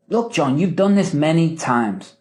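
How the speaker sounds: MANY TIMES dropping at the end as a command; statement tune, falling close on MANY TIMES. Shown as a demonstration. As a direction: tell them firmly, falling on TIMES. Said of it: "That's a command".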